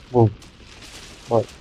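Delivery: normal talking voice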